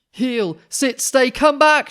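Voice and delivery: loud, high dog-owner commands; very loud, high yelled commands; shouted commands. Acted. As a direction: bark orders at runaway dogs. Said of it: "shouted the dog's people".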